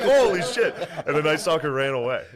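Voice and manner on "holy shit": high, loud startled shout; shocked yelp. Retold or imitated. Couple waking shot in the head, shocked shout. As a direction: blurt it out in shock.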